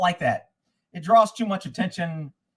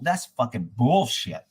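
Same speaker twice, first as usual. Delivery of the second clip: low, drawn-out grumble; disgusted, deflated; 8 semitones lower, slower than usual. Retold or imitated. Caller giving in to the deputy's answer, disgusted and deflated. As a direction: drop the pitch and stretch it out. Retold resigned reply to deputy.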